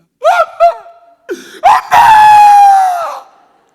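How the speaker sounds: demonstrated high, screaming wail for mother; hammed-up bereaved daughter's shriek. Shown as a demonstration. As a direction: cry out at full force, hugely drawn out. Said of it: "딸은 이렇게 웁니다".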